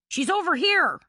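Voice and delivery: high, loud call. A narrator's reading. Billy calls Bannister over to them, loud. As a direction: shout to guide him over. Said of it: "Billy called to Bannister".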